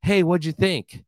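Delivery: high, bright-eyed post-show question; excited friend. Retold or imitated. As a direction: gush up high like a hyped performer. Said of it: "and she goes".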